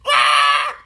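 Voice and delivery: loud exaggerated wail; mock outcry. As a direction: cry it out in hammed-up protest. Mock outcry over Africans not tipping.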